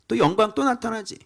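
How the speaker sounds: ordinary talk, nothing marked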